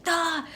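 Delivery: sharp, loud high cry of frustration "Ah!"; frustrated shout. Retold or imitated. Blocked calls to family, a frustrated yelp. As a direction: burst out as the call drops again. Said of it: "I get annoyed".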